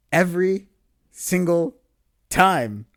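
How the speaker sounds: "Every", "single", "time" each punched separately; word-by-word emphasis. Real, not acted. Stresses his grievance word by word.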